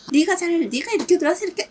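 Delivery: agitated blame, pitched well up; acted heated quarrel. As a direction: act out flaring up at the other driver. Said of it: "이런 거 말고, 그러면 이제 서로, 서로 이러고 막 난리가 나니까".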